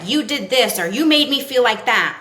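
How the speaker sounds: blaming. Acted. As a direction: throw the blame at them, loudly. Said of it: "when we blame".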